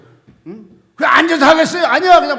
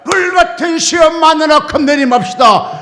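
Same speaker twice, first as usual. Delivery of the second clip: loud proclamation of the hymn words; loud exclamation; 4 semitones higher, 9 dB louder than usual. Retold or imitated. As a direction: burst the line out loud. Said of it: "내 입에 튀어나온 소리가". (불같은 시험을 크게 외치듯)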